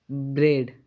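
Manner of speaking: ब्रेड said firmly, a not heard; silent a demo. Shown as a demonstration. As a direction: model ब्रेड clearly, no a sound. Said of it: "ए silent हो गया". (ब्रेड को ज़ोर से, ए बिना, बोलिए)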